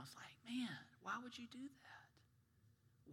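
hushed, breathy rebuke; soft pained whisper. Real, not acted. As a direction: whisper it like a disappointed secret.

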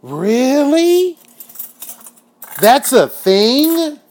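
can't believe this is real; stunned disbelief. Real, not acted. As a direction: gasp it out in shock. Shocked at commissary money.

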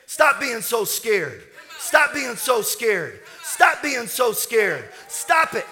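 slow, loud, emphatic pulpit shout; fervent preaching. Real, not acted. Preacher ordering the congregation to stop being scared, shouting emphatically. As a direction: build to a stretched, high scriptural cry.

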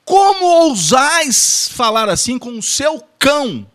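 hammed-up grand indignation; mock outraged shout. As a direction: roar the reproach like a tragic actor. Mock archaic rebuke, repeated.

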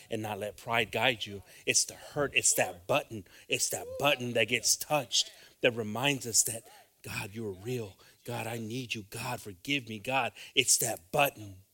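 loud, driving sermon delivery; full-force preaching. Acted.

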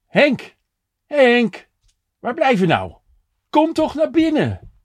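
high, loud call from across the street. A narrator's reading. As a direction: call his name out to bring him inside. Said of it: "hoorde hij zijn naam roepen".

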